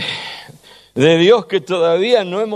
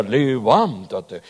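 slow, lower divine command; deep voice of God; 6 semitones lower, much slower than usual. Retold or imitated. Song line where God calls Lazarus, in a slow deep command. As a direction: draw it out low and heavy. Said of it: "busqué yo el tono más grave".

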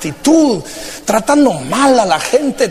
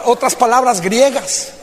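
usual speaking voice

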